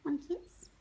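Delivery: ordinary talk, nothing marked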